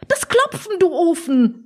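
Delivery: very high-pitched impatient retort; exasperated scolding. Acted. Oma scolds sleepy Opa who hears nothing, exasperated.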